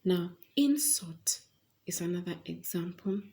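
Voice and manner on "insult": IN-sult, "insult" with a strong first syllable; stress on first syllable. Shown as a demonstration. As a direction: punch the start of "insult" as a noun. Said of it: "the stress falls on the first part".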